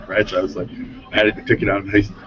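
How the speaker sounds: ordinary talk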